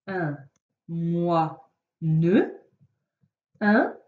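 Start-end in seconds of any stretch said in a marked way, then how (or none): 0.9-2.5 "moi, ne" said as two syllables with a pause between; split into syllables. Shown as a demonstration. Teacher showing a child the syllables of moine, slow separate beats. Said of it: "Le découpage en syllabes".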